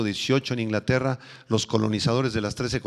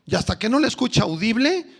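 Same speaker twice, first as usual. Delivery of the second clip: put-on complaining young man; 6 semitones higher than usual. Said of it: "otro joven vino y me dijo".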